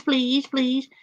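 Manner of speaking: high, rapid-fire imitation of her begging; mocking whiny pleading. Host showing what infuriates them about her, mock whiny pleading. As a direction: parody her repeated whining pleas. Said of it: "when she does the whole".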